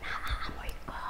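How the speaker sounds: very soft, voiceless aside; whisper. Retold or imitated. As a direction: whisper so only your neighbour hears. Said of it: "Bumulong ako sa katabi ko". (pabulong sa katabi)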